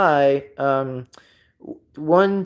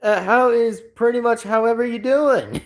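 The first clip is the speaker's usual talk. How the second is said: high-pitched, drawn-out Southern character voice; hammed-up impression of a folksy Southern greeting; Mater Southern accent; 9 semitones higher, slower than usual. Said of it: "doing the Sovereign accent by Mater".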